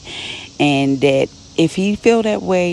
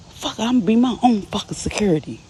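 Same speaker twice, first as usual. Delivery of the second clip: frustrated outburst; 6 semitones higher, faster than usual. Retold or imitated. Murda triggered, storms out.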